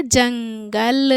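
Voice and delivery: जंगल drawn out in two parts, nasal hum on जं; nasal anusvar demo. Shown as a demonstration. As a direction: stretch जंगल slowly, ringing the ng through the nose. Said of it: "जंग बोलने पे अंग निकल रहा है".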